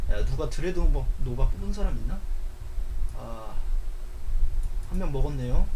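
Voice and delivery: 낮고 작은 목소리; 힘 빠진 채 확인하듯; 낙담한 혼잣말. Real, not acted. 남의 당첨을 보고 낙담. (기운 없이 낮게 말하기)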